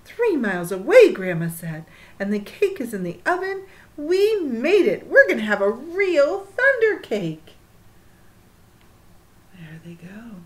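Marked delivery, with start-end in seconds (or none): 0.1-1.1 excited grandma. A narrator's reading. 2.2-7.6 loud happy triumph; excited grandma. A narrator's reading. 9.5-10.4 hushed whisper. Real, not acted.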